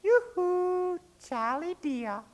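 very high, clear mermaid call; tinkly little voice. A narrator's reading. Mermaid calling sweetly to Charlie, tinkly and clear. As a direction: sing out a pretty, tinkly yoo-hoo. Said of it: "tinkly and clear, a pretty little voice says".

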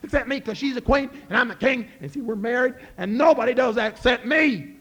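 loud, high jealous ranting; king shouting in a rage at Jack; furious shout. Acted. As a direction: bellow at the man who kissed your wife. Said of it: "King, are you mad?".

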